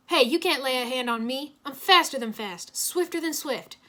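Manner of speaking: bragging. A narrator's reading. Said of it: "Griffin bragged".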